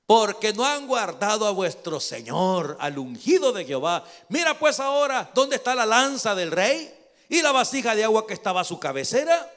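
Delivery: loud declamatory rebuke; shouted accusation. Acted. David accusing the guards who slept, shouted accusation. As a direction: call them out across a distance.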